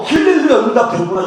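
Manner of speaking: high, loud burst of joy; overjoyed, tearful praise; joyful hallelujah. Retold or imitated. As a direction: cry out hallelujah as the prayer breaks through. Answered prayer, tears of joy.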